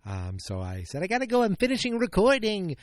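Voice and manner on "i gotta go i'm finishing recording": high, loud hurried brush-off; raised voice telling wife he must go; urgent rushed quote. Retold or imitated. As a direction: blurt it out to get off the phone. Retelling telling wife to hang up.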